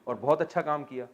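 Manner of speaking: usual speaking voice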